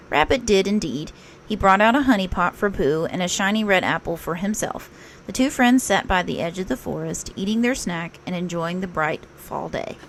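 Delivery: plain reading voice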